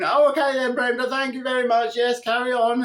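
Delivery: high, breezy call to Brenda; mock cheery shout. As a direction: brightly wave her on, hammed up.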